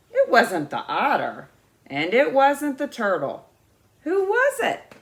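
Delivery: lightly voiced young beaver, puzzled; a small beaver wondering aloud; little beaver asking. Little beaver puzzling it out for the wise beaver.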